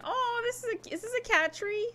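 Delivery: high-pitched, delighted discovery; light, high voice on spotting something cute; delighted surprise. Real, not acted. Gamer spotting a cat tree, delighted surprise.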